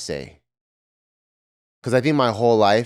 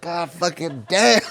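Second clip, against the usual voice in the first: slow, drawn-out mock groan of disgust; a kid hating the itchy sweater, dragged out; grumbling kid; 4 semitones higher than usual. Third grader in a wool sweater grumbles, slow and disgusted.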